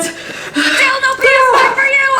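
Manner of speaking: high, slow taunting call; gleeful taunt. Acted. Jumping out of the box at the kid, taunting. Francine pops out of the gift.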